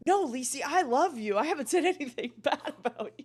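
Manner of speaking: mocking sweet backpedal. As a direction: play him suddenly sweet and innocent. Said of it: "And he goes".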